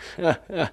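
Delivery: short high burst of acted laughter; brief theatrical "ha, ha" from a judge; judge's mocking laugh. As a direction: laugh out at the prisoner's tale. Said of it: "El juez echó a reír".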